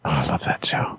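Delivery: soft breathy whisper. Real, not acted. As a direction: whisper it fondly. Whispered aside.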